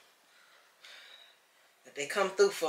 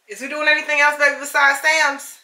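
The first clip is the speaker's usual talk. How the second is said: loud, raised-pitch question to someone across the house; calling out; 10 semitones higher, 8 dB louder than usual. Real, not acted. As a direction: call over to your partner. Calls question to partner off-camera.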